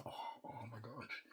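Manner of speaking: breathless whispered awe; awed whisper. Real, not acted. Fan remembering a great scene, whispered awe. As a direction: drop to a hushed gasp of wonder.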